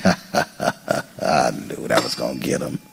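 very low, slow, soft devil gloat. Retold or imitated. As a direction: purr it low like a pleased devil.

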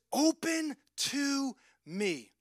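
slow, drawn-out pleading invitation; tender plea. Acted. Preacher voicing Jesus' plea.

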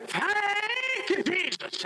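high, ringing praise cry; mama's thank you. Retold or imitated. Mama crying thanks to Jesus, high and ringing.

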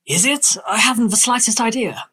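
airy, whimsical Victorian author; dreamy storybook eccentric, slightly lifted; Lewis Carroll. Acted. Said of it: "that, uh, performance".

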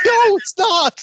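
high, loud mock protest; panicked denial. Fan defending himself to girlfriend, frantic denial. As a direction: plead innocence in a hammed-up squeal.

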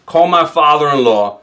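ordinary delivery

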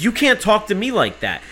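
retold heated comeback; indignant retort. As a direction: throw it straight back, indignant. Retorting to agent in quarrel.

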